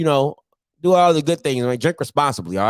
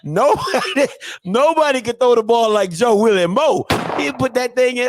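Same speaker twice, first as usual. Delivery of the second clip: put-on higher, hyped-up old-timer bragging; old-head impression; 6 semitones higher than usual. Old head praising Joe Willie Mo, hyped mock boasting. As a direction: gush like an old head about a legend. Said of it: "That's how they be sounding".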